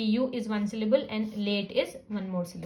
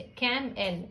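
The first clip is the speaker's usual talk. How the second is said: कैम एल split into two beats, softer; two sound units; 4 dB quieter, much slower than usual. Shown as a demonstration. Teacher repeating the camel split, कैम एल in two units. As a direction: separate कैम from एल. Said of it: "इस तरह से".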